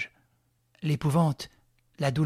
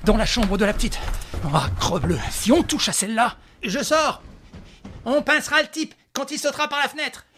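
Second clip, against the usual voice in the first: urgent, high, loud alarm; 7 semitones higher, 6 dB louder than usual. Acted. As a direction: shout in sudden alarm. Alarm at night scream.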